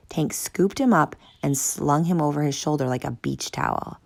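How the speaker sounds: ordinary delivery, nothing marked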